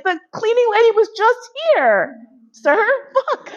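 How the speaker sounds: high put-on voice, fussy and fed up; mock exasperated maid. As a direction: play the annoyed cleaning lady. Imitating exasperated housekeeper.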